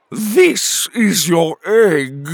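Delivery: very slow, loud, high character yell; drawn-out outraged holler; exasperated shout. Acted. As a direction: stretch each word in loud indignation. Chicken angrily reveals the culprit.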